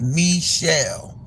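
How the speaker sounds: Michelle said "mee-SHELL"; French-style first syllable. Shown as a demonstration. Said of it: "supposed to be pronounced".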